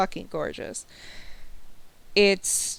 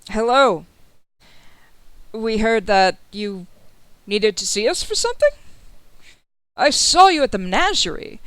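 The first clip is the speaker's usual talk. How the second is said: louder, lifted voice of a welcoming noble; loud, animated host; genial host greeting; 2 semitones higher, 8 dB louder than usual. Acted. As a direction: greet guests expansively, pitch swooping up. Adello greets the visiting players.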